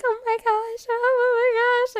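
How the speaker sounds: high, loud, drawn-out overwhelmed exclamation; happy near-crying. Retold or imitated. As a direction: re-enact being moved to tears by good news. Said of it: "I was, like, crying".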